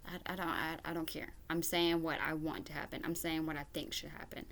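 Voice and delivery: ordinary delivery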